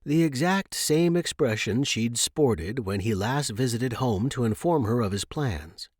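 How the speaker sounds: plain reading voice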